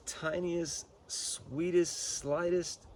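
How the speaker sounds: high, slow, dainty emphasis; high and delicate. Real, not acted. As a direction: lift the voice to show something tiny. Stressing how gentle the burn is.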